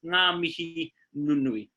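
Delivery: "Ngā mihi nunui" said slowly and clearly; slow pronunciation. Shown as a demonstration. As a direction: model "Ngā mihi nunui" at half pace. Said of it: "lovely way to sign off".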